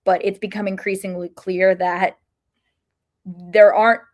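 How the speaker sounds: ordinary talk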